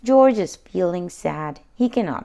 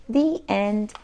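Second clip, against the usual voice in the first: usual speaking voice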